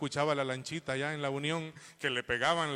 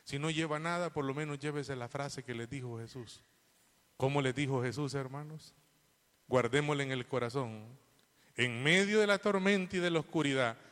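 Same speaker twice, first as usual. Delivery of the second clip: ordinary talk, nothing marked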